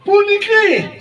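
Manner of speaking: high, ringing stage cry; dramatic cry. Acted. Warrior announces the weapon he will launch, high dramatic cry.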